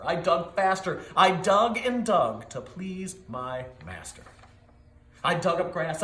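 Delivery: usual speaking voice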